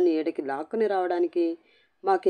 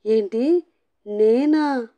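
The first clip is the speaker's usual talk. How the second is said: higher, louder jolt of disbelief; stunned disbelief; 6 semitones higher, 5 dB louder, much slower than usual. A narrator's reading. Husband accused by his wife, stunned disbelief. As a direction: gape and blurt it out in shock. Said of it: "కప్పలా నోరు తెచ్చాడు".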